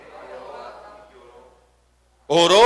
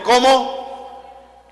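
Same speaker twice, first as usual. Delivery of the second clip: questioning prompt; 8 semitones higher, 6 dB louder than usual. Real, not acted. Prompts congregation for the name.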